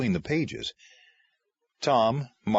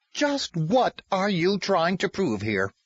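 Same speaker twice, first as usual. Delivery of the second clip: angry demand; 9 semitones higher than usual. Acted. Bledsoe confronts Tom angrily.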